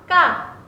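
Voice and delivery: 까 said tight and sharp, no breath; tense Korean 까, tight throat. Shown as a demonstration. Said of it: "까, 까 means".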